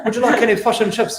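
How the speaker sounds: put-on playful New Zealand accent, vowels in fish and chips flattened to fush and chups; mock New Zealand accent. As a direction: tease like a Kiwi selling "fish and chips". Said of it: "had a New Zealander song?".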